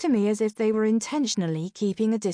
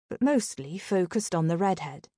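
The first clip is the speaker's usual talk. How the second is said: ordinary delivery, nothing marked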